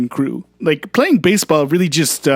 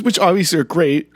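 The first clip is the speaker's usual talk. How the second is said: ordinary talk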